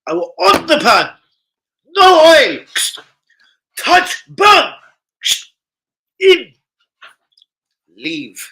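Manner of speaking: high, loud hammed-up chef commands; mock chef. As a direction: play a ranting TV chef barking steps. Mock chef cooking demo voice.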